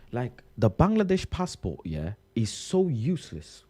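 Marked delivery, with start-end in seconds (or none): none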